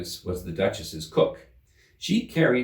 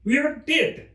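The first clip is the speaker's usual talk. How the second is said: high, louder retort; insistent Hatter; 5 dB louder than usual. Acted. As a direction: insist back.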